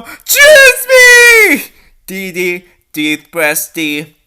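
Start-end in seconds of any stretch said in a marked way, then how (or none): 0.0-1.7 high, loud shouted plea; urgent shout. Acted. Rescuer shouts up to the princess to trust him. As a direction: yell it up to her with everything.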